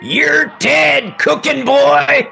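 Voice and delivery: high, loud Southern yell, slowing at the end; Southern heckler shout. Retold or imitated. Southern heckler in the crowd threatening the chef, loud shout. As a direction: bellow it like a Southern wrestling fan. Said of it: "I hear some guy in a Southern voice go".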